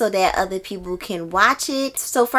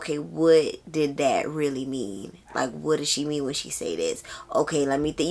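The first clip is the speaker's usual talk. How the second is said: quieter, musing to herself over a hard passage; puzzled self-questioning; 5 semitones lower, 7 dB quieter than usual. Retold or imitated. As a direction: mutter it like thinking aloud mid-read. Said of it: "you got to ask yourself".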